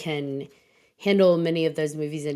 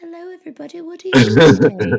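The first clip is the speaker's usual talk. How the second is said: put-on light British accent, cheery greeting; a hint of a polite British host saying hello; 10 semitones higher, 11 dB louder, faster than usual. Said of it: "he's got this British accent".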